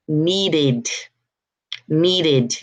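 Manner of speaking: "needed" said as two syllables, need-id; extra syllable. Shown as a demonstration. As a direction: sound out the -ed of "needed" as id. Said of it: "adding an extra sa".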